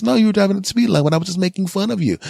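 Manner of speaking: high and fast, teasing; mock friend's voice. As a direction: chirp it back like a carefree friend. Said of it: "I said".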